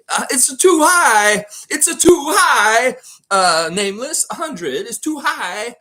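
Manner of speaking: shouted high and loud, drawn out; sing-song shout of too high; loud high call. Real, not acted. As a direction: yell it's too high at chat. Streamer shouting guesses too high.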